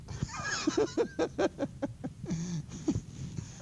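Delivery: long high-pitched giggle. Real, not acted. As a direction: let a real giggle run on. Laughter after joke to class.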